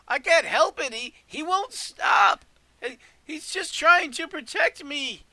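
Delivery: high, loud, drawn-out wail of a gnome; yelling gnome. Acted. Game master voices a gnome defending its protector, high yell. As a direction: yell it high and pleading, stretching the words. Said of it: "you hear the gnome yell".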